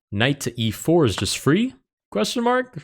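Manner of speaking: "free" and "mark" rising high like a question; incredulous question. Real, not acted. Chess player spots a free knight, incredulous questioning. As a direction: query it in disbelief, pitch leaping up on "mark". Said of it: "I say question mark".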